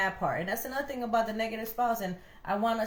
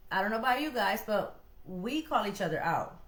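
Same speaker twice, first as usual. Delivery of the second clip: usual speaking voice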